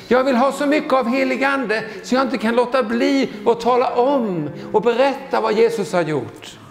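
ordinary talk

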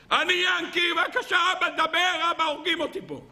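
put-on high pleading child's voice calling father; child begging. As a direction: beg dad to talk, desperately. Second child begs his father. (ילד מתחנן לאבא)

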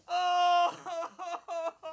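hammed-up wailing cry, half laughing; mock boohoo sob. Elephant cries alone at home, mock wailing sob. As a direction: fake a big cartoon sob for the kids. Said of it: "Elephant cried".

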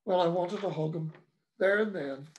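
ordinary delivery, nothing marked

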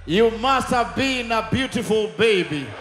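ordinary talk, nothing marked

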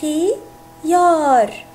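خيار split slowly into خِي يَارْ; teacher sounding out خيار for children; syllable by syllable. Shown as a demonstration. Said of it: "مثل كلمة".

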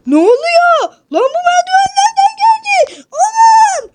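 yüksek, şaşkın çığlık; gözlerine inanamıyormuş gibi bağır; şok. Acted. Murat merdiveni görüp şaşırıyor. (merdiveni gören Murat şaşkınlıkla bağırıyor)

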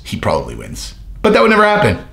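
ordinary delivery

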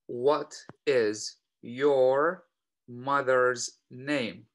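very slow, word by word; slow model question. Shown as a demonstration. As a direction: draw each word out for learners. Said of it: "هنا السؤال الأول". (نطق بطيء جدًا كلمة كلمة)

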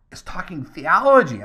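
high and loud, amazed. Retold or imitated. Pastor reliving his amazement at a young visitor, high and loud. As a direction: marvel at it.

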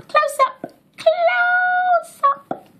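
sing-song British close-up call, rising and rising; British accent. Pretend. Vlogger zooming in, repeating close-up in British accent. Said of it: "enough British accent for today".

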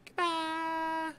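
mock crying. Mock crying goodbye to boss.

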